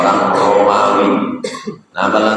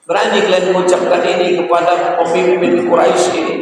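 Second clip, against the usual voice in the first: high indignant rebuke; Abu Bakar's rebuke; 9 semitones higher, faster than usual. Retold or imitated. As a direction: scold them, raised high. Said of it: "Apa kata Abu Bakar?".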